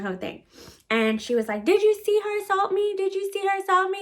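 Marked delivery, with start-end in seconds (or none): 1.6-4.0 high-pitched, mock outraged appeal; mocking mimicry. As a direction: imitate a complainer pleading with a bystander. Mimics the screaming woman appealing to witness.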